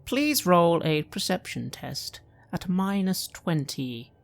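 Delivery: ordinary talk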